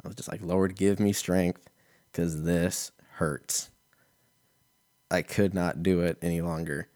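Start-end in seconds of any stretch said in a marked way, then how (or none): 0.4-3.7 slow, pained mock prayer. Comedian mock-praying through stomach pain, slow. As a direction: plead to the Lord, drawn out.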